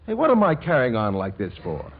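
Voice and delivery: ordinary talk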